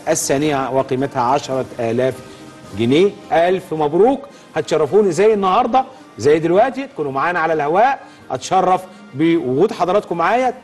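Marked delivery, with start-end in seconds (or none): 3.3-10.5 upbeat, higher TV host voice; hyped giveaway announcement. Acted. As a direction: sell it like a game show host. Host congratulates winners on air.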